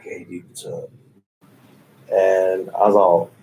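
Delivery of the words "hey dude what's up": very soft, whispery imitation of a woman; Kat Von D. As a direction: mimic her casual hello almost without voice. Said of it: "She kinda has that voice".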